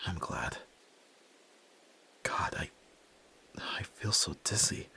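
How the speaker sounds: breathless, whispered relief; shaken whisper. Acted. Son shaken after facing his father, whispering. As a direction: barely get the words out, drained.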